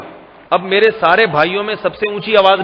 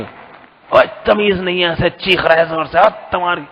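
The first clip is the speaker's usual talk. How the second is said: rough acted telling-off at usual volume; mock screaming scolding. Parent telling off a shrieking child, mock scolding. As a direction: play a parent scolding, without real yelling. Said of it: "خود اسے چیخ چیخ کے ڈانٹیں".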